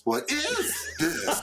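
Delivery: "is" drawn out high and slow; stress on is. Retold or imitated. Teasing a cohost about his stress, "is" stretched high and laughing. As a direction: lean hard on "is" with a laugh. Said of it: "did you put the emphasis on the is".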